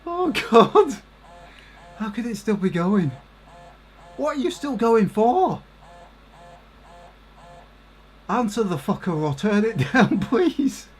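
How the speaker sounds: high, laughing pleas at the screen; hysterical giggles. Real, not acted. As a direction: beg the game to stop while laughing. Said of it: "I just went into hysterics".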